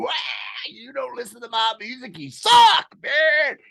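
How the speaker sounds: screaming Southern rant. Retold or imitated. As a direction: scream it at the city folk. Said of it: "I'm flying around everything and going".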